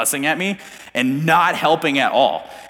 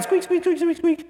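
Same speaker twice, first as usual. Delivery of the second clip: high squeaking noise imitation; squeaky window crank.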